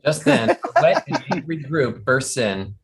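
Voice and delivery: ordinary talk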